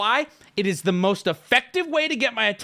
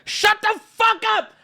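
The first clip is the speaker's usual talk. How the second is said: annoyed shout; 5 dB louder than usual. Real, not acted. Snapping at a chat heckler.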